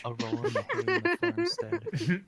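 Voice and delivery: slow, loud, high melodramatic announcer; dramatic title reading. Pretend. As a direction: announce it like a grand book title. Reading out a silly erotic title.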